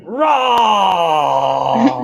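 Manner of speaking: sudden loud high roar; jump-scare roar for the filter; playful monster rah; mock monster roar. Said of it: "all of a sudden you're like".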